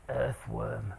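very low, soft drone; mimicking deadpan kids. Comedian imitating two hundred silent kids answering, deadpan low drone. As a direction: drop it right down, like bored kids in unison.

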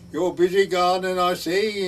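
Welsh voice. Retold or imitated. Welsh parson greeting a gardener over the wall, Welsh accent. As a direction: put on the parson's Welsh lilt. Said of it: "in his Welsh voice".

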